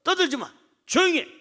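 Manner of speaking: high, short commands to silence someone; acted shushing rebuke. Crowd blocking the beggar's prayer, acted high-pitched scolding. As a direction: play irritated bystanders hushing a noisy man. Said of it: "기도를 방해하는 거예요".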